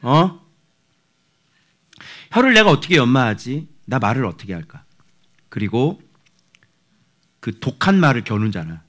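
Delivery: ordinary delivery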